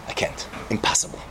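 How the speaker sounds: hushed and scared, backing away; fearful whispered refusal. Acted. Best friend whispers his refusal to the doomed man. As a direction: whisper it, barely daring to refuse.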